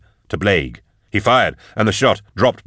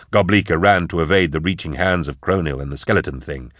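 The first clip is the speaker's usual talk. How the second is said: usual speaking voice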